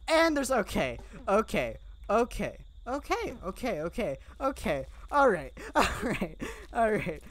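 flustered, panicked okays; rushed nervous repeated okays; panicking. Real, not acted. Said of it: "I'm mostly just panicking this episode".

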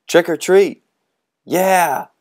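high loud cheer; excited cheer. Acted. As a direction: yell it gleefully. Kids cheering.

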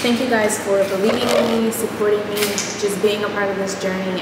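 speaking in an empty, echoing room; echoey room. Real, not acted. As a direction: voice bouncing off bare walls. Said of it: "It's so echoey in here".

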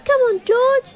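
a chorus of excited animals; eager animals. A narrator's reading. Animals urging together.

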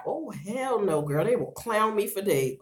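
normal talking voice

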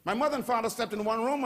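usual speaking voice

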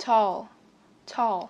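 "Tall" with the tongue lifted for a clear L; short model "Tall", L completed; final L sounded. Shown as a demonstration. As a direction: finish "Tall" on the L. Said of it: "Đưa lưỡi lên ạ".

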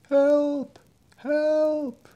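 high, far-off desperate cry; distant cry for help. A narrator's reading. Drowning men call for help from the bay, crying out. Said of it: "He had heard a cry".

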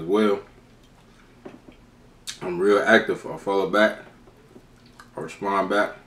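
eating between words; talking while chewing. Real, not acted. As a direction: mouth full of chicken. Seen 3: Repeatedly bites wing held at mouth while talking throughout.

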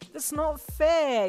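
high, loud, drawn-out whine; pouting mock complaint, stretched out; playful pout. A narrator's reading. Protesting to Hawks after being caught, playfully pouting. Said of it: "you pouted playfully".

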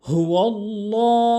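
হুয়াল্লাহ said loud with a light ল; wrong pronunciation. Shown as a demonstration. Teacher voices the mistake to avoid. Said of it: "হুয়াল্লাহ বলা যাবে না".

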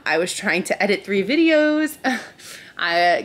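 ordinary delivery

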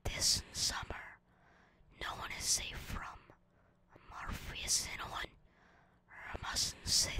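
hushed trailer whisper; horror trailer voice. Pretend. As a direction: breathe it like a scary movie teaser.

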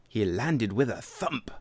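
usual speaking voice

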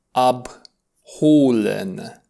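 abholen stretched very slowly, syllable by syllable; very slow model word. Shown as a demonstration. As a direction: draw out ab-ho-len for learners. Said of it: "vom spune".

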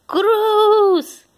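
bright high exclamation; excited. Real, not acted. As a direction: announce the name with delight. Mom excitedly naming toy car.

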